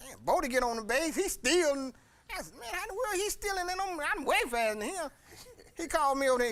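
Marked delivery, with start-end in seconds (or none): 0.1-5.1 high, excited retold disbelief; shocked he's stealing, voice jumps up; surprised disbelief.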